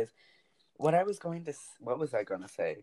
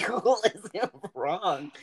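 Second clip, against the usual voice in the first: higher, drawn-out incredulous protest; retold exasperation; 7 semitones higher, 3 dB louder, much slower than usual. Niece retelling argument with aunt over time, exasperated. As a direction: imitate yourself protesting in disbelief.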